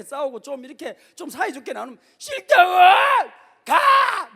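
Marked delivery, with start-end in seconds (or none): 2.2-4.3 high, loud, drawn-out screaming refusal; imitating a child's tantrum. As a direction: scream it like a toddler guarding his toys. 아들이 장난감 안 나눔.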